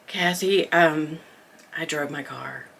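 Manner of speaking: low, quiet, embarrassed; sheepish admission. Retold or imitated. Teen sheepishly telling her friend she drove herself, low and quiet. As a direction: confess it meekly, trailing off. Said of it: "I come to my senses and I go".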